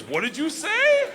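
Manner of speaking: high, drawn-out cry of disbelief; amused incredulous yell. Retold or imitated. Gangster to panicked student who spoke Russian, amused incredulous yell. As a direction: draw it out loud and very high, mock incredulous.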